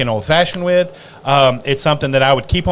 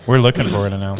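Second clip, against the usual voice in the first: laughing; 5 semitones lower, faster than usual. Real, not acted. Banter about announcing, laughing.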